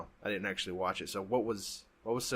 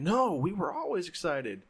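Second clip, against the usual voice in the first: drawn-out, higher-pitched mock protest; mock screaming; 7 semitones higher, 3 dB louder than usual. Imagined listeners objecting to the podcaster, mock scream. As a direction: imitate outraged fans, stretched and high, without really screaming. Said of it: "screaming at me saying".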